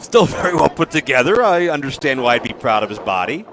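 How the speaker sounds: ordinary talk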